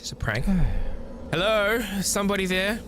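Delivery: ordinary talk, nothing marked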